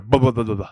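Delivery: "bu-, bu-, bu-" babbled as toothless mush; toothless bubble. Shown as a demonstration. Mimicking the toothless bouncer, "bu-, bu-" blubbered. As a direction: stammer "bu-" over and over like a toothless man. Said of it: "That's the way he spoke".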